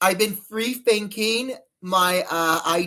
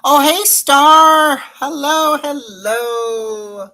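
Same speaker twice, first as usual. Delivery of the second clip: excited greeting; 8 semitones higher, 10 dB louder, much slower than usual. Real, not acted. Greeting a viewer joining stream.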